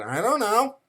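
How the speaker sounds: put-on high, clueless little-kid voice; five-year-old child. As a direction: answer like a baffled small child. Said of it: "asking your five-year-old children".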